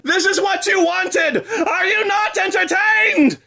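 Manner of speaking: high, loud, slow mock arena taunt; mock gladiator shout. Taunting viewers like an arena gladiator. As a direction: bellow it like a gladiator.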